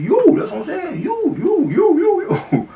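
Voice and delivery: high, loud, eager you you you; mock eager friend singling him out; excited pointing. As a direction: jab the word you over and over, thrilled.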